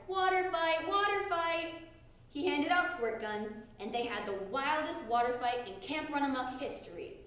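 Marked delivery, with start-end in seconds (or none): 0.1-1.7 hollered. A narrator's reading. Mugwort hollering to start a water fight. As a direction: holler it with a laugh. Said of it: "laughed and hollered".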